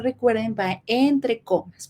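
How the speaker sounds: ordinary talk, nothing marked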